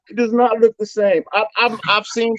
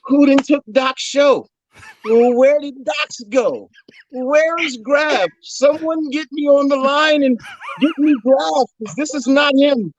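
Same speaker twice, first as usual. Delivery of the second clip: shocked disbelief; 8 semitones higher than usual. Retold or imitated. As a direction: play it as stunned disbelief. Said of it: "I turned on the screen yesterday and was like".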